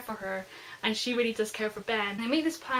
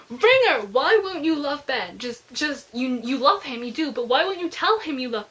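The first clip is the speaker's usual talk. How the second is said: exasperated plea; 2 semitones higher, 6 dB louder than usual. Real, not acted. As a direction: beg the character to confess. Pleading with a character.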